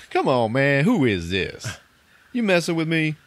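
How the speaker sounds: acted annoyance at a suspected prank caller; irritated disbelief. Retold radio guy annoyed by caller.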